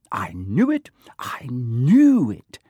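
breathless stage whisper building in force; triumphant whisper. A narrator's reading. Girl finds the answer in her book, triumphantly whispering. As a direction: whisper it, then stretch and push the repeat.

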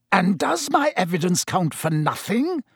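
mild, high-pitched snarl; indignant question, lightly snarled. A narrator's reading. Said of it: "snarled Snape".

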